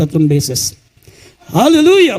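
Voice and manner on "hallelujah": high, loud joyful shout; shouting while jumping. Real, not acted. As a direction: shout it out as you jump. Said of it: "Sabay-sabay sumigaw habang tayo tumatalon".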